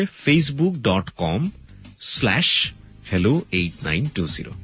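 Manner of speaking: slow, careful web address; slowly spelled out. Shown as a demonstration. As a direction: dictate each part slowly. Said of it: "বানানটা হচ্ছে".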